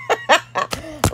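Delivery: high giggle burst; real high-pitched laughter; laughing. Real, not acted. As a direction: crack up at yourself. Cracks up at own accent.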